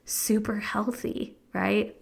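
hushed confiding whisper. Real, not acted. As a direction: whisper it like a secret. Confiding aside about unhealthy food.

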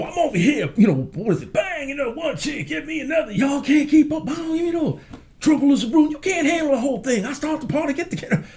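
high, wild party-starting yell; high excited shouting rap-show energy; hyped-up performance. Retold or imitated. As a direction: fire up the crowd like on stage.

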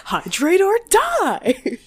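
high, slow, hammed-up battle cry; laughing mock war cry; shouted catchphrase. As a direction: yell it like a slogan with a laugh.

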